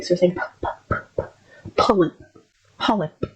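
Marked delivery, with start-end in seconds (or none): 0.3-1.2 soft puffed p sounds from the lips, repeated. Shown as a demonstration. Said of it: "say ... the syllable itself repetitively". 1.8-3.2 "polyp" with a light breathy P from the lips, not a B; clear p sound. Shown as a demonstration. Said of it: "So say polyp".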